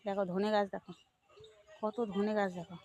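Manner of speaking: usual speaking voice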